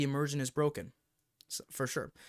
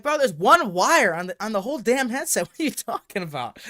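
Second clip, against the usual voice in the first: exasperated retort; 7 semitones higher, 9 dB louder than usual. Retold or imitated. Retorting to the clueless YouTuber.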